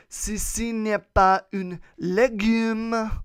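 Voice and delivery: high, loud, slow French declaration; showy French. Acted. Podcaster rewording the picture's caption, grand showy French. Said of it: "could actually say".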